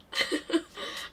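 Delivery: soft breathy giggle. Real, not acted. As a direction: let a quiet giggle slip. Laughs at own joke.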